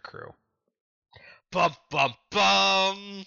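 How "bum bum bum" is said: loud high dun-dun-dun; dramatic sting. Pretend. Podcaster scoring Nami's reveal with a loud mock sting. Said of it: "dramatic sting".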